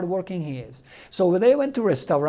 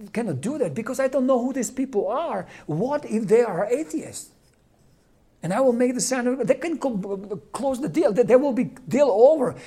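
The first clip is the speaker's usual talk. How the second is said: panicked retold plea, voice pitched up; anxious pleading; 5 semitones higher than usual. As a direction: beg the Lord not to ask it of you. Man pleading with God, panicking.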